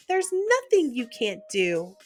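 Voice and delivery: ordinary delivery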